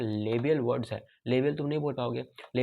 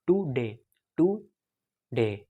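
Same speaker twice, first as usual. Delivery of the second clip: "today" split into separate syllables, louder; separated syllables; 6 dB louder, much slower than usual. Shown as a demonstration. Teacher showing undue syllable separation, "today" broken apart. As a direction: pull "today" apart with a gap between syllables. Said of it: "ऐसे बोलेगा".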